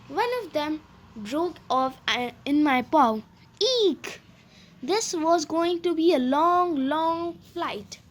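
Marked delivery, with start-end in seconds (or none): 3.6-4.2 a high startled mouse squeak; fearful eek. A narrator's reading. Mouse grabbing a broken armrest, high frightened squeak.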